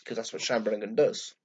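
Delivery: ordinary delivery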